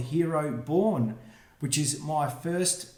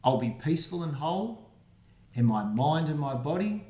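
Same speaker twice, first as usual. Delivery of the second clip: put-on reading to children. Reads rhyming picture book aloud.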